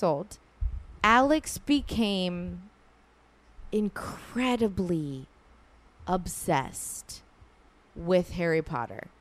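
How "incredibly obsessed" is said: "incredibly obsessed" drawn out, each word set apart; emphatic slowing. Real, not acted. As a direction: lean slowly on "incredibly", then pause before "obsessed". Stresses how obsessed Alex became.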